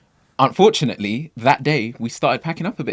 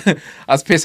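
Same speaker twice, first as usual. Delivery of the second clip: repeated, higher and laughing more; laughing harder; 6 semitones higher, 6 dB louder than usual. Real, not acted. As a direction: let the laugh take over the repeat. Laughing retelling missed celebrity.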